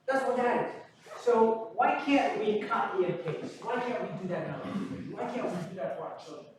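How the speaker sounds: normal talking voice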